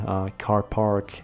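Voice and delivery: ordinary talk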